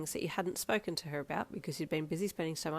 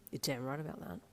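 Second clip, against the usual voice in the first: provocative low tone; 4 semitones lower, 7 dB quieter than usual. Acted. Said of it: "in a provocative low tone".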